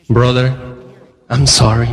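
low-pitched mock sympathy; acted condolence. Coworkers to a man losing his job, acted condolence. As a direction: imitate a sorry colleague, pitched low.

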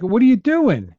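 high-pitched mock disbelief; exasperated, high "what on earth"; incredulous retold question. Acted disbelief at friend's jump.

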